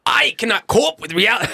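high, loud mock German accent; failed comic German accent; bad German accent. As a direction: shout it in a hammed-up German accent. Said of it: "Do a German accent right now".

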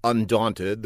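plain reading voice

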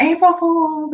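light mock of mom gloating; teasing mom. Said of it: "And she's like".

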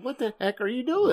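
high, mock-exasperated question; disbelief. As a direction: act baffled at your kid. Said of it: "I'm looking at him going".

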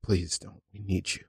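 soft, low begging; tender plea. Acted. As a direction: beg them gently to stay. Improv plea to departing hero.

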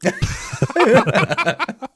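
hoher, heller Lacher; laugh. Real, not acted. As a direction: lach laut los. Laughing at joke.

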